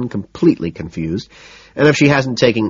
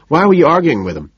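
higher and louder question; 8 semitones higher, 5 dB louder, faster than usual. A narrator's reading. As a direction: dodge with a high, louder question. Patrick dodging her question.

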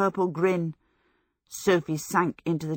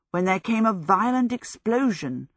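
ordinary delivery, nothing marked

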